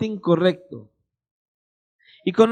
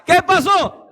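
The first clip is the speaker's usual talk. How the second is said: high, louder greeting; imitated friend; 8 semitones higher, 4 dB louder, slower than usual. Friend greeting the preacher at the door, high and casual. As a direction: toss out a bright casual hello.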